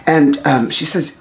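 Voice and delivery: ordinary delivery, nothing marked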